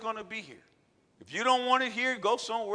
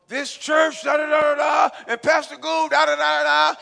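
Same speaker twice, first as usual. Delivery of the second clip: higher, loud mock yelling rant; mock ranting man; 8 semitones higher, 15 dB louder than usual. Pastor imitating an angry man shouting outside the church. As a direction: ham up a ranting lunatic shouting. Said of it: "He just screaming, top of his lungs".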